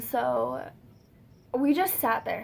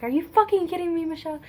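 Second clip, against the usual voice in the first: high, fast, louder retort, imitating an outraged brother; incredulous outburst; 7 semitones higher, 6 dB louder, faster than usual. Brother to sister who just called the cops, incredulous. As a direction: fire it off in mock disbelief.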